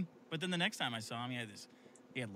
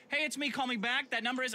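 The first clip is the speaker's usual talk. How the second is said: bright, high voicemail voice; phone voice; 10 semitones higher than usual. Retold or imitated. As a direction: lightly mimic a voicemail greeting. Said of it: "have a whole different vo".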